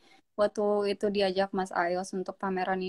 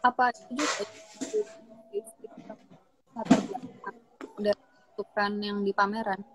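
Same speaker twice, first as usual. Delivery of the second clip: bad connection. Real, not acted. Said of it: "Suaramu putus-putus".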